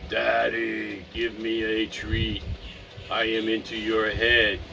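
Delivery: low, slow begging dog; pleading dog voice. Pretend. Owner voices his dog begging for a treat, low and slow. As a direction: beg like a dog working a mind meld. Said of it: "He goes".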